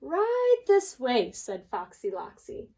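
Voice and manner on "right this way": sly fox. Acted. Fox leads them to his den.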